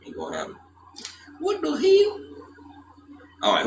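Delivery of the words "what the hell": surprised. Real, not acted. Sudden outburst at the screen.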